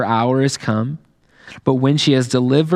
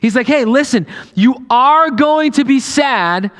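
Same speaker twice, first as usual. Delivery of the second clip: preacher rousing the congregation; preaching at full force; 10 semitones higher than usual. Acted. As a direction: build toward hope.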